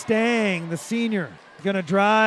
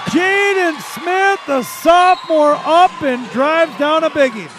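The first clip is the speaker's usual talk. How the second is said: loud, high sportscaster shout, easing at the end; excited scorer call; 7 semitones higher, 7 dB louder, slower than usual. Real, not acted. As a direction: hail the scorer with drawn-out, booming names. Announces the scorer of the point.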